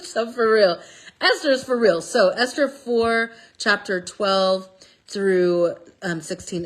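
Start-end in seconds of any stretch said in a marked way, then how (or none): 0.0-2.0 a laughing, emphatic for real; excited. Real, not acted. To viewers about Esther, excited and emphatic. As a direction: hype it up after the laugh.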